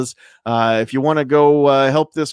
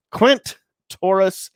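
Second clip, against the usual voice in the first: name split in two with a gap, raised pitch; split up, slow; 6 semitones higher than usual. Real, not acted. Host reads a favorite screen name from the list, split up slowly. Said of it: "split that up and say it a little bit slowly".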